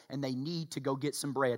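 usual speaking voice

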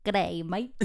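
attempted Australian greeting; Australian accent. Shown as a demonstration. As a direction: try out a stock Australian accent. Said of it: "Can you do an Australian accent?".

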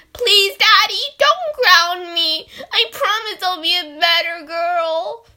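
put-on high, loud begging little girl; pleading child. A child begging Daddy not to ground her, high pleading. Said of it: "That's scaring me".

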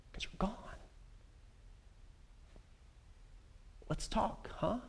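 very soft, nearly swallowed; dejected. Acted. Elijah alone with an absent God, dejected hush. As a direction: let it fall away after long silences.

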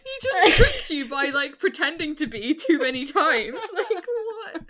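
loud, high talk through laughter; laughing rant. Real, not acted. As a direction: rant while cracking up. Laughing about the poem's claim.

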